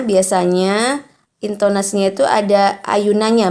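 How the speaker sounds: normal talking voice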